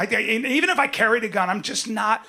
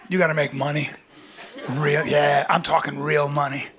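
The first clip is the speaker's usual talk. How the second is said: low, knowing delivery; low punchline; 6 semitones lower than usual. Real, not acted. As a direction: drop the voice for the payoff.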